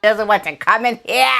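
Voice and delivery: hammed-up British royal accent asking a question; posh British royal accent. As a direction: lampoon a British royal, plummy and indignant. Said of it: "in his very British royal, royal way of saying".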